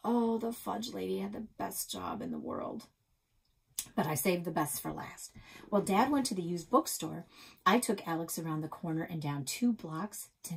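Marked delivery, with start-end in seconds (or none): none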